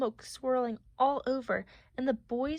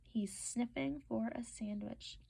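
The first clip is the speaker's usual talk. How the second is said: whisper; 11 dB quieter than usual. Acted. Whispered aside while reading.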